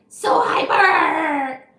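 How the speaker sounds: slow loud stretched-out cry; loud playful exclaim. Pretend. Streamer reacting to a hyper viewer, loud slow exclaim. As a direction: belt it out slow and big.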